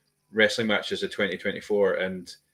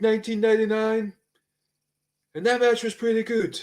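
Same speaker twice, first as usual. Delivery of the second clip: Bret Hart; 12 semitones higher, 5 dB louder than usual. Retold or imitated. Fan mimicking a wrestler rambling on the mic, high droning impression. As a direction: drone on one raised note with long pauses. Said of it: "that's his tone".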